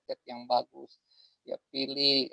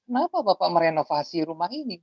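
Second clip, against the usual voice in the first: slightly offended; 7 semitones higher, 3 dB louder than usual. Retold or imitated. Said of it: "dengan sedikit tersinggung atau marah".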